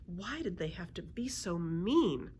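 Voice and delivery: ordinary delivery, nothing marked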